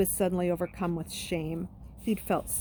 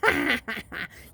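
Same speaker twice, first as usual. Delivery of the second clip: put-on jeering boy's laugh; mocking laugh; 5 semitones higher, 4 dB louder than usual. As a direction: laugh at him. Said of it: "Jackal Boy started laughing first".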